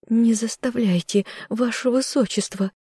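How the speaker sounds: high, frightened maid's voice; pleading servant girl, pitched high; trembling plea. A narrator's reading. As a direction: beg fearfully to be spared. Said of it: "Её губы дрожали".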